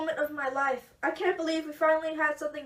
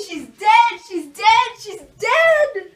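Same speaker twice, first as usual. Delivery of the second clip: loud cries, much louder than usual; grief yell; 5 semitones higher, 11 dB louder, slower than usual. Acted. Mother crying out her daughter is dead. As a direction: yell it again and again. Mother repeats the news, yelling.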